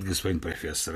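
lightly voiced fat visitor; low polite visitor. Visitor answers the professor, polite and low.

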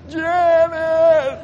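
high, loud mock-panicked yell; panicked cry. Preacher re-enacts his panic attack, high panicked yell. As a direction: scream out for help, hammed up. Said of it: "I panicked".

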